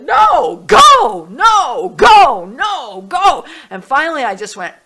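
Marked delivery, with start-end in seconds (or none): 0.0-3.4 high, loud tug-of-war; shouted inner argument. Retold or imitated. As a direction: fire two opposing voices back and forth at full volume. Two inner voices fight it out.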